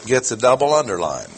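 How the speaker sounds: usual speaking voice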